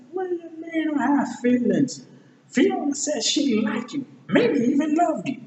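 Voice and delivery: very high acted exclamation; high-pitched character voice. Retelling talk of Fiona's feelings, high-pitched character voice. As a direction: squeal it out as a cartoon sidekick. Acts Donkey's excited protest.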